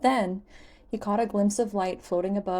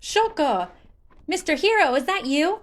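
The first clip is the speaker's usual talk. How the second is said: put-on high, loud, childish excitement; a huge creature bursting with glee; delighted Hestu; 9 semitones higher, 7 dB louder than usual. Hestu greeting Link, high excited childish voice.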